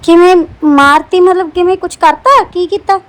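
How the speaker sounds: loud, urgent demand for details; alarmed questioning. Acted. Madam loudly presses the maid about the murder. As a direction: press her anxiously for what happened.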